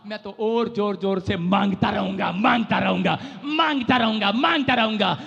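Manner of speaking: loud repeated chant; shouted preaching. Acted. Preacher voicing the beggar's vow to the crowd, shouting.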